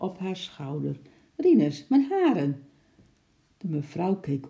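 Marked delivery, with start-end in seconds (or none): 1.4-2.6 high, loud exclamation of protest; startled protest. A narrator's reading. Wife to supposed husband touching her hair, startled protest. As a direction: cry out in raised pitch.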